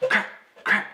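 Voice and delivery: high loud crack noises; imitated cracking. Driver imitating the car breaking, sharp high crack sounds. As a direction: mimic the suspension snapping.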